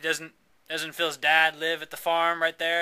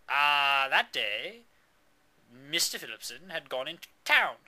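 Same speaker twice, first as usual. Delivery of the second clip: ordinary talk, nothing marked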